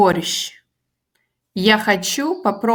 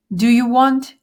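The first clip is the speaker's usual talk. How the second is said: "Do you want?" with a rising question tune on want; question intonation; 4 semitones higher, 4 dB louder than usual. Shown as a demonstration. Teacher showing how intonation makes a question. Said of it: "you just simply change your intonation".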